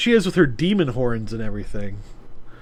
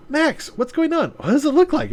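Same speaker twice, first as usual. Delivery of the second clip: higher voice; 12 semitones higher than usual. Acted. Maya and Max voiced in dialogue.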